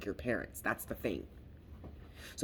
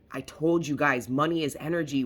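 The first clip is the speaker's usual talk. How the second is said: ordinary delivery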